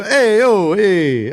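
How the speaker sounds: big Brooklyn hey yo; mock Brooklyn accent. Mocking Hollywood Italians in a Brooklyn accent. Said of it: "They don't go".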